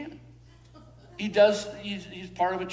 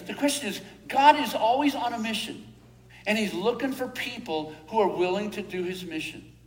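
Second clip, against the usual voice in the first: ordinary talk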